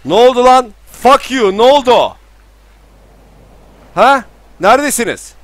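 high, loud bewildered yells; shocked shouting. Real, not acted. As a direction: shout in stunned confusion. Şok içinde bağırıyor. (şaşkınlıkla bağırış)

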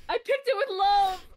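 loud, high, drawn-out protest; mock-hurt wail. Friend defends her berry gift, mock-hurt wail. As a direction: wail it out in over-the-top hurt.

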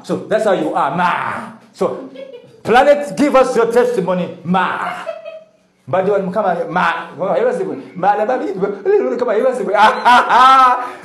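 loud, high teasing through laughter; cheerful hollering tease; laughing shout. Real, not acted. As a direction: rib her loudly while laughing.

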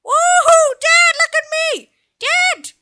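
put-on a little boy yelling for his father's attention; excited child voice. Son calling out to his dad in the game, a high, excited kid's shout.

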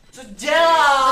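slow, loud, high yell; loud outburst. Real, not acted. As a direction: shout it in disbelief. Outburst during the game.

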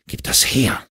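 breathy, panted demand; gasped order; gasping. A narrator's reading. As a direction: pant it out, short of breath. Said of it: "keuchte er".

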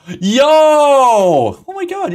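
long, high, loud whoop; excited shout. Real, not acted. Streamer one sub off the goal, amazed high yell.

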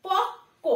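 পক্ক with a doubled k, the ব silent; correct pronunciation demo. Shown as a demonstration. Teacher reads out the first word for pupils, correct pronunciation. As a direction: say পক্ক as pok-ko, a held double k. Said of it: "এটা কিন্তু পকব না".

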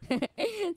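short high, soft giggle. Real, not acted. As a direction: chuckle helplessly at the mishap. Laughs after dying in game. (చిన్నగా కిసుక్కున నవ్వు)

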